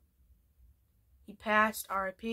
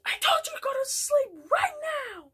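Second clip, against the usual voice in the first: shrill mom threat. Retold or imitated. Mom with a gun ordering Eric to sleep, shrieking high. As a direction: screech it like a furious mother. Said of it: "she pulls out a gun and says".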